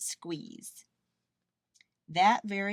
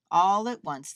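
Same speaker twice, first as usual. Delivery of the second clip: ordinary delivery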